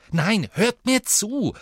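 sharp, high angry snap, slowed; angry hiss. A narrator's reading. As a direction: cut them off with fury. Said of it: "zischte er wütend".

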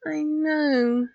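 high, drawn-out sympathy; soothing. A narrator's reading. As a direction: coo it consolingly. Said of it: "patting his head soothingly".

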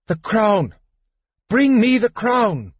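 high-pitched furious cry, acted; enraged shriek. A narrator's reading. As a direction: shriek the demand in a rage. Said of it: "hear Kitiara shriek in rage".

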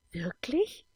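a touch of thrill for adventure; adventurous eagerness. A narrator's reading. Veronika hears of pirate ships, eager for adventure. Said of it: "in ihrer Stimme lag Abenteuerlust".

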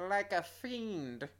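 slow, sinister villain growl; evil supervillain voice. Pretend. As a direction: play it like a scheming fiend. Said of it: "evil supervillain voice".

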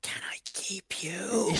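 slow, drawn-out whisper; hushed, airy whisper in imitation; whispered movie line. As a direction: whisper it creepily slowly, imitating a film ghost. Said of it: "he whispers in her ear".

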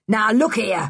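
short angry protest. A narrator's reading. Man seeing his supper walk away, angry. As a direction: object gruffly, with a touch of anger. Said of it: "began Mister Stick angrily".

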